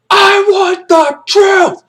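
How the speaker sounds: very high, slow yelled demand; shout. Retold or imitated. Kaffee screams for the truth at Jessup. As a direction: scream it at him. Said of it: "Kaffee's like".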